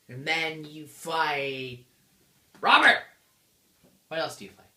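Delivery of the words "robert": loud, high comic outburst; sudden shout. Acted. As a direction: blurt the name at full volume. Sudden shouted name in boss list.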